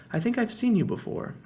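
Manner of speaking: put-on dreamy, contented girl's voice; drowsy and content, half awake. A narrator's reading. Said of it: "in a dreamy, contented sort of voice".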